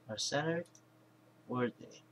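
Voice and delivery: ordinary talk, nothing marked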